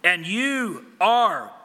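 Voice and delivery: slow, loud accusing voice of the Lord; forceful rebuke. Acted. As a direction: preach it like a finger jabbed in their chest. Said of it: "finger right in their chest, and he says".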